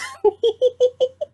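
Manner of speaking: squealing giggle; helpless high laughter. Real, not acted. Laughing at her own story. Cracking up again.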